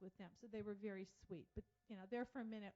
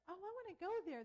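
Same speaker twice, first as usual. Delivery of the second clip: eager excitement; 10 semitones higher, 8 dB louder than usual. Retold or imitated. Husband eager to visit pirate place.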